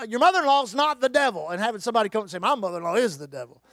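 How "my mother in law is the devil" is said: lower, quicker voice with a changed timbre for a congregant's retort; imitating a congregant; mock congregant. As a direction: toss it off as a grumbling churchgoer.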